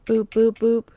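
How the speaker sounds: usual speaking voice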